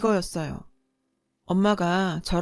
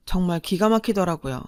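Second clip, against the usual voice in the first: plain reading voice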